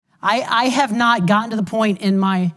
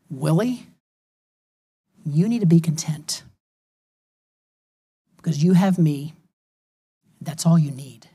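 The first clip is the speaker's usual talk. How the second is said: voice of God; 3 semitones lower than usual. Retold or imitated. God reassuring a discontented believer, gentle divine voice. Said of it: "And God is saying".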